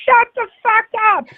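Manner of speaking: shrill-sounding pretend yell at normal volume; mock inner scream. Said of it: "I'll sometimes scream to Joannis".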